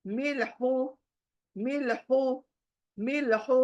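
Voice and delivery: मिल्हू with a breathy throat ह; throaty h in मिल्हू. Shown as a demonstration. Teacher with finger on throat: throaty मिल्हू. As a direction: push the ह of मिल्हू from the middle of the throat. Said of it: "और हा निकालो".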